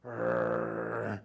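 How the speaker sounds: long, loud mock roar at a bear; loud growl. Shown as a demonstration. Hiker meeting a bear on the trail, growling loudly. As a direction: roar as if facing off with an animal. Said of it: "the last thing you wanna do is go".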